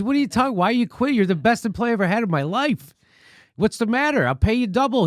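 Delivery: a lightly voiced desperate boss; pleading boss. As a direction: beg him not to quit. Rancher begging worker to stay.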